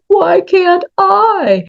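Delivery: high, slow, stretched-out whine; pity party whine. Retold or imitated. Sulking at her own body in a high, slow whine. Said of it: "have the pity party".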